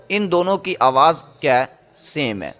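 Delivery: normal talking voice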